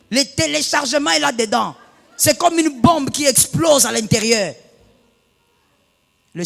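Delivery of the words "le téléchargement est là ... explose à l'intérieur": loud fervent sermon cry; shouted preaching. Acted. Pastor describing exploding anger, shouted preaching. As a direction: shout it to the congregation.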